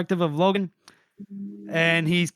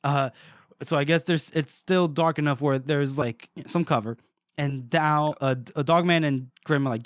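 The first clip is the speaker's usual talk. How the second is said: ordinary talk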